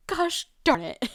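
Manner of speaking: high, slow mock exasperation; exasperated. Frustrated mate searching.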